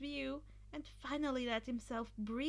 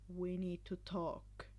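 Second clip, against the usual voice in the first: lower, softer, slow serious tone; calm voice; 6 semitones lower, 4 dB quieter, slower than usual. Acted. A calm stranger summoning Izuku, serious and low. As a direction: say it flat and level. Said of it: "unfamiliar yet calm voice".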